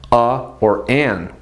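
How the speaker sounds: ordinary talk, nothing marked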